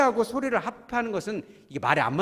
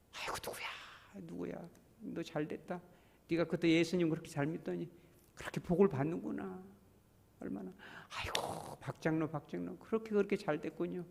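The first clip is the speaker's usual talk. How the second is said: hushed delight; 3 semitones higher, 7 dB quieter than usual. Retold or imitated. Congratulating a blessed brother.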